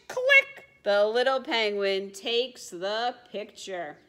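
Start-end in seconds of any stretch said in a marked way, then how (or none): 0.1-0.6 very high, loud click; camera click. A narrator's reading. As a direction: snap it like a shutter. Camera sound effect.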